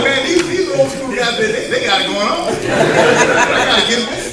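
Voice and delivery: high, loud mock amazement; impressed, playful exclamation. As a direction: exclaim in joking admiration, playing to the crowd. Acts out his impressed reaction.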